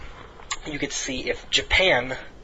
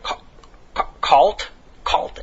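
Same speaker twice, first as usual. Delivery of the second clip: stumbling over the name; 4 semitones higher, 6 dB louder, much slower than usual. Retold or imitated. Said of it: "he stumbles over it".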